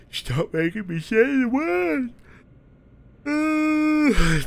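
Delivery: high, slow protest through a yawn; talking through a yawn. Real, not acted. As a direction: complain while a huge yawn takes over. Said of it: "that makes me yawn".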